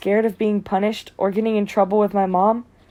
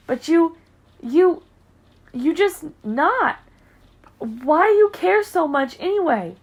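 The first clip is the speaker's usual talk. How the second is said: upset, voice rising; 7 semitones higher than usual. A narrator's reading. Boy upset with coach.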